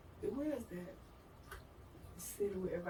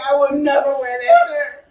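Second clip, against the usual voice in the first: mock vow; 5 semitones higher, 12 dB louder than usual. Retold vow about the shirt.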